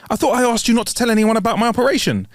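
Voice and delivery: high, rushed indignant complaint; outraged. Acted. Outraged patient phoning her surgeon about a broken promise. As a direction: demand an explanation, fast and high-pitched. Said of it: "Outraged, she immediately called".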